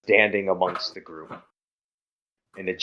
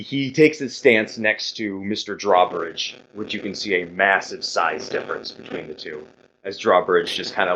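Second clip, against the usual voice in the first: usual speaking voice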